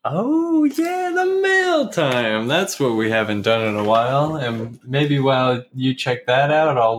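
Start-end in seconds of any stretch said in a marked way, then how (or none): 0.0-2.5 high, drawn-out mock excitement; antusias pura-pura. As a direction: stretch it out high, hyping the segment. Greets the returning mail segment.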